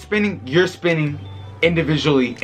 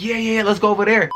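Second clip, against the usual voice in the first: higher, eager mock girl voice; imitating eager girl; 7 semitones higher than usual. Retelling how the girl eagerly agreed to everything, in a higher mock voice. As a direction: chirp it back like she agrees to anything.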